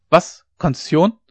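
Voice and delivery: jolted awake, sharp rising question; old dike reeve wakes with a start, baffled; startled outburst. A narrator's reading. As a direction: snap out of a doze, baffled. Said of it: "sah fast wild".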